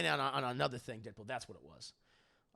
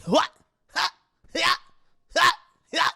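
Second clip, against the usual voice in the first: short, separated combat yelps; imitated sword-swing grunts; 14 semitones higher, 11 dB louder than usual. Streamer mimicking a game hero's attacks, short fighting cries. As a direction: yelp with each imagined sword swing. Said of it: "Yeah, that sound".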